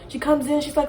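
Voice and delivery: usual speaking voice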